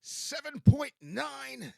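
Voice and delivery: high, loud, slow announcer voice; announcer read. Acted. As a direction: broadcast the moon phase like a radio host. Reads the moon report.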